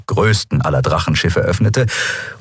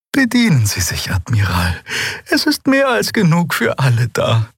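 higher, lightly voiced flattered host; Xea, flattered; 7 semitones higher than usual. A narrator's reading. Xea invites the admiral to help himself, flattered. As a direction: offer the food, pleased and gracious.

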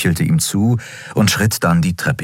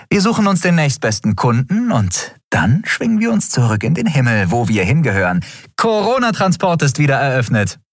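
lightly raised voice for the captain Endriel; a wry young captain, higher than the narration; Endriel voiced; 9 semitones higher than usual. Endriel's dialogue voice.

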